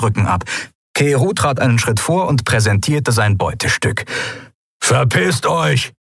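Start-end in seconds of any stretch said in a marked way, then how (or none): 4.8-5.9 slow, raised menacing growl; drawn-out gruff warning; growled threat. A narrator's reading. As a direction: grumble it as a threat. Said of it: "brummte er und drohte".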